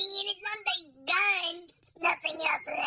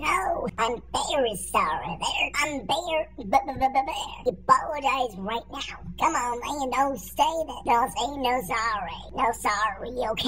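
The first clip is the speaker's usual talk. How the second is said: put-on low old man voice; 8 semitones lower than usual. Old man demands an apology.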